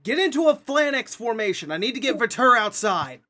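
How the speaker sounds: loud, high battle order; yelling. Acted. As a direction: bellow it over the chaos. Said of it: "yelling at everyone".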